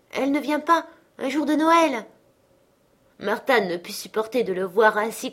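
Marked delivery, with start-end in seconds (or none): 0.1-2.1 dismayed father. A narrator's reading. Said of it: "frappé d'un abattement soudain".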